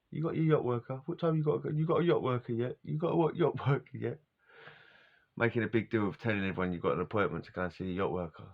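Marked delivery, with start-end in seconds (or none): 0.1-4.2 mock bragging lads. Mimicking boys bragging about appointments.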